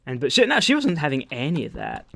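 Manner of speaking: usual speaking voice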